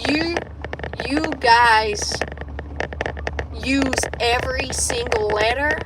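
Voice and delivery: over-articulated mock American; careful, exaggerated American delivery; attempted American accent. Shown as a demonstration. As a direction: put on an American accent, sounding every letter. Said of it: "you guys pronounce every fucking letter".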